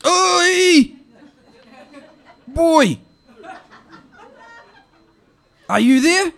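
put-on high, loud calling out to the boy; an old auntie hollering at an answer phone; high loud auntie voice shouting for her nephew. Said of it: "it goes like this".

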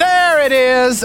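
exasperated "knew it"; sarcastic triumph. Acted. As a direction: crow it slowly, fed up. Customer's sarcastic triumph at breakage.

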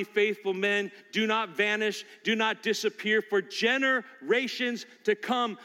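ordinary talk

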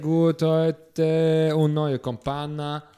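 broken German with Italian lilt; fake Italian accent. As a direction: play a hammy Italian gelato man. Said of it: "Fake-Italienisch gesprochen".

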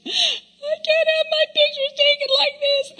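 put-on high, teary wail of a teen girl; imitated crying daughter. Mom imitating her daughter in tears before picture day. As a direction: cry it out like a heartbroken teen. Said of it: "she's in tears".

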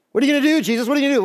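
fretting disciples asking Jesus what now; panicked disciples. Retold or imitated. Disciples in a flap before Jesus, pitched high.

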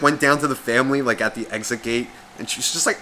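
normal talking voice

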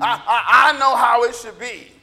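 loud, mock self-righteous insistence. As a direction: imitate a know-it-all laying down the law. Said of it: "they become very self-righteous".

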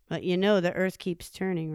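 ordinary delivery, nothing marked

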